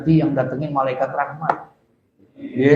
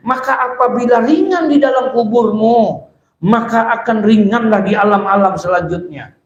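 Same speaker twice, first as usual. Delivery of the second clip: high, declamatory recitation and exposition; forceful preaching; 8 semitones higher, 4 dB louder than usual. Acted. Preacher warning listeners about the grave, high declamation. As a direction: proclaim it in a raised, carrying voice. Recites then expounds at raised pitch.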